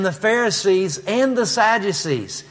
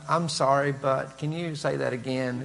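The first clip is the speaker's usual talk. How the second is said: a softer, lower sheepish student voice; timid student; 4 semitones lower, 8 dB quieter than usual. Retold or imitated. Shy student begging the teacher to repeat, sheepish. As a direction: shrink back and ask meekly. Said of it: "he was so timid".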